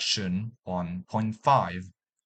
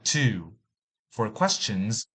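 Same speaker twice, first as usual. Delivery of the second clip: ordinary delivery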